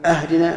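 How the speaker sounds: أهدنا opened with a short a on the hamza; wrong fatha on hamza. Shown as a demonstration. A teacher showing the wrong opening vowel on اهدنا. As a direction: start أهدنا with a, not i. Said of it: "أو يفتح همزة اهدنا".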